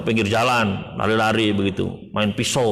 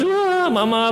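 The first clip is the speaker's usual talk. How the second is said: put-on high wailing child crying for mama; pitched way up like a sobbing toddler; much slower than usual. Preacher imitating a sobbing child calling for its mother, high wail.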